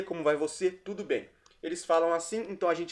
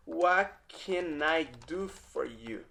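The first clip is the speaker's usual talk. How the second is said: slow demo; 6 dB quieter, much slower than usual. Shown as a demonstration. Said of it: "teacher models phrase slowly".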